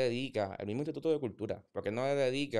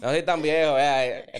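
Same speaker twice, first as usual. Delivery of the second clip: loud, higher-pitched mock protest; joking protest; 5 semitones higher, 10 dB louder than usual. Real, not acted. Man jokes to guest about his age, loud protest. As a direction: protest loudly, playing it for laughs.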